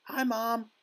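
put-on high, mouse-like squeak of a boy; tiny little voice. A narrator's reading. Shrunken boy greets mother from the television, tiny voice. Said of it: "A tiny little voice".

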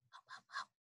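very soft whispered hops; hushed hop hop. Shown as a demonstration. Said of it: "but much quieter than before".

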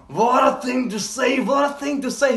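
high-pitched, gushing admiration; amazed exclamation. Real, not acted. Moved by a character's words.

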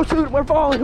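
normal talking voice

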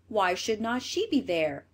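ordinary delivery, nothing marked

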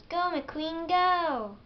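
high cheering yell, building on the last word; loud, high, drawn-out final cheer; pit crew yell. A narrator's reading. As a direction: yell it like a crew urging on the racer. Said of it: "His pit crew yells".

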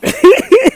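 high giggling fit; laughing. Real, not acted. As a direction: break into a real tittering laugh. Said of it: "why I'm laughing is because".

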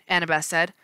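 usual speaking voice

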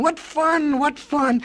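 high-pitched excited exclamation; gleeful yelling. A narrator's reading. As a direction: cry it out like a delighted crowd. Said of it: "everyone yelling and screaming".

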